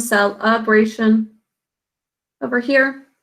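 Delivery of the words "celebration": slow "celebration" as SEL-UH-bray-shun; muffled uh vowel. Shown as a demonstration. Teacher explaining the unstressed E, "celebration" slowly as sel-uh-bray-shun. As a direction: show the uh clearly in "celebration". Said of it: "It ends up saying an uh sound".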